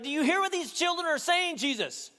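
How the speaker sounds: high-pitched indignant challenge; indignant shout. Retold or imitated. Religious leaders challenge Jesus over the children, indignant shout. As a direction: shout it over a noisy crowd, outraged. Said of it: "They shouted over the top".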